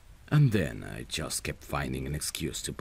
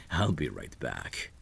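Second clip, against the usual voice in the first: normal talking voice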